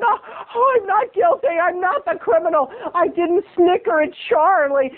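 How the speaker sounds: very high, frantic wail; hammed-up protest of innocence. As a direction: plead innocence in a melodramatic high voice.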